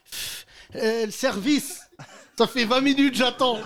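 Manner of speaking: voix plus aiguë, râlerie amusée de client; père impatient imité. Retold or imitated. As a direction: imiter en riant un client qui s'impatiente. Père impatient au restaurant, imité en riant. (client qui râle, imité en rigolant)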